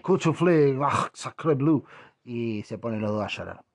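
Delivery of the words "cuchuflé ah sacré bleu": louder fake French, sighing end; hammed-up French gibberish; mock French. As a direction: play the fussy Frenchman. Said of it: "Y el otro dice".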